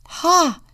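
loud high triumphant ha. A narrator's reading. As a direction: bark a gleeful ha. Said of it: "cried the Contessa".

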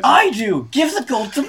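high-pitched squabbling demand; insistent demand. Real, not acted. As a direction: claim it for yourself, voice shooting up.

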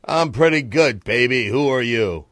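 loud, swaggering crooner talk. Acted.

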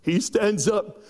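ordinary delivery

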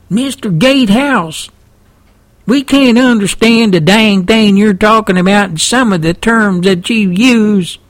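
high-pitched mock complaint in a Texan twang; complaining listeners. Host voicing baffled listeners' emails, mock complaining. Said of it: "getting emails from y'all saying".

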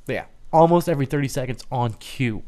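ordinary delivery

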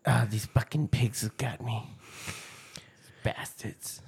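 hushed dying gasp; dying words. Retold or imitated. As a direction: breathe out your last words. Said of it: "South Park movie".

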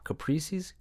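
"Caprices" said as kuh-PREE-siz, rising, unsure; "Caprices" as a puzzled guess; unsure pronunciation. Real, not acted. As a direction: try out "Caprices" questioningly. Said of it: "I'm just trying to rhyme".

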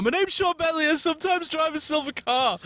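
put-on high babbling drunk blurt; drunken blurt. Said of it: "I just blurt out".

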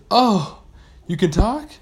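high, loud gasp of a question; astonished. Acted. Pirate amazed by a talking shark, high surprise. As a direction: blurt it in amazement.

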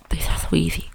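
whispered under the breath; whispering. Real, not acted. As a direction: breathe it out as a private aside. Muttered aside while picking words.

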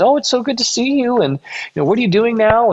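high, bright-eyed mock gush over an old friend; imitating an overjoyed church lady; gushing greeting. Church lady spotting him in the back, imitated gushing.

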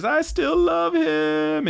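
very high, drawn-out mock wail; mock crying. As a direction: wail it out like a lovesick cartoon. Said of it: "as she's crying. And she says".

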